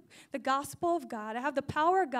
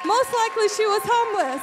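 higher, much louder preaching cry; emphatic shout; 6 semitones higher, 9 dB louder than usual. Real, not acted. Preacher repeats the point to the congregation, emphatic shout. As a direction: drive the line home at full voice.

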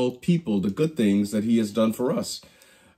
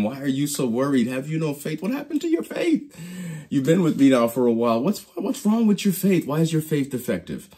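gently chiding; 5 semitones higher than usual. Acted. Jesus questions disciples' faith.

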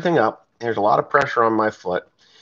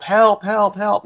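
retold yell for help; 9 semitones higher, slower than usual. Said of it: "I'm yelling for help".

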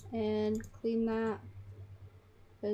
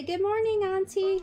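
high, loud sing-out greeting; cheery greeting; 8 semitones higher, 6 dB louder than usual. Acted. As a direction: call it out brightly to a relative. Voices a Sim greeting auntie.

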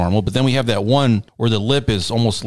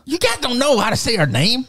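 high-pitched mock Southern caller; Southern accent; 14 semitones higher than usual. Casino caller complaining about their name, badly faked Southern accent. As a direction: put on a hammed-up Southern accent. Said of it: "very, like, Southern or something".

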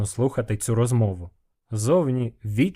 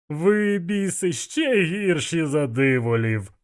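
slow, drawn-out disgusted complaint; wry disgust; 6 semitones higher, slower than usual. A narrator's reading. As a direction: start high and drag it out in distaste. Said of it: "скривився Іштван". (протяжне кривляння з відразою)

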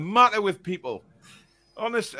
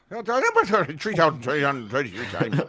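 put-on slow, swooping comic Irish accent; mock Irish accent; 2 semitones lower than usual. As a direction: ham up a dodgy Irish accent. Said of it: "that was like a Tom Cruise accent of, of the Irish".

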